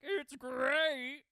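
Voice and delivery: very loud, high diva cry. Retold or imitated. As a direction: blare it as a hammed-up singer impression. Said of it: "Cher, and she's like".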